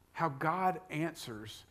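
ordinary talk, nothing marked

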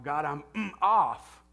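hesitation, then a loud burst on off; mock angry prayer. Pastor joking about a near-curse in prayer, loud blurt. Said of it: "saying the P word".

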